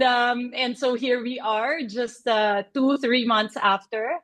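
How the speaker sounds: usual speaking voice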